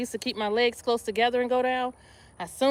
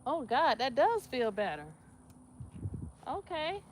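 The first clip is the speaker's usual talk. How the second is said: ordinary delivery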